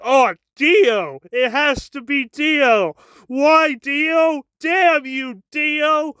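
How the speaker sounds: high, hammed-up anguished outcry; mock yelling. Jonathan blaming Dio for his troubles, melodramatic mock yelling. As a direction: overplay the blame, pitch climbing. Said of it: "he just yells".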